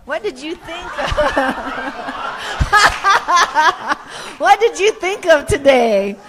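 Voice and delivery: asking while laughing; can barely get the question out for laughing. Real, not acted. Laughing through a poll question.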